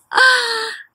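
big theatrical gasp; dramatic gasp. Acted. Teacher reading aloud to kids, a theatrical gasp.

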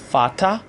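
فاتا with an f at the start and "a" ending. Shown as a demonstration. Teacher modelling German father, فاتا starting with f.

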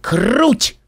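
high, gleeful exclamation; high-pitched cheer; excited yell. A narrator's reading. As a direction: whoop it out in delight. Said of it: "завопила Эпплблум".

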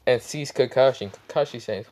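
ordinary delivery, nothing marked

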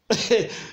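brief high chuckle; a quick real laugh. Real, not acted. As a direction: laugh lightly before going on. Preacher amused at own example.

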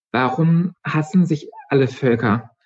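put-on a touch of a young girl; girl asking. A narrator's reading. Mitra asks.

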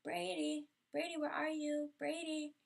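anxious calls for the missing dog; calling out. Retold or imitated. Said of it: "So I was like".

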